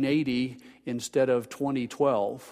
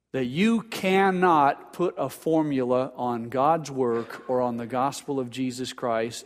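ordinary delivery

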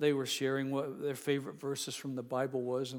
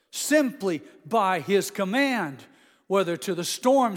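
rising, loud pulpit build; sermon climax, ringing out; full-force preaching; 5 semitones higher, 8 dB louder than usual. Acted.

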